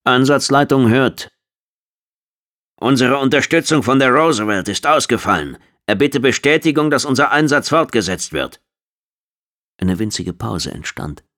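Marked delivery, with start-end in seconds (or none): 0.0-1.3 slow, high, loud radio reply; radio operator. A narrator's reading. Mission control answering the shuttle, projected radio voice. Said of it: "Gallaghers Stimme antwortete". 2.8-8.6 raised, high-pitched military report; formal radio report. A narrator's reading. Officer asking command to confirm mission, formal radio voice.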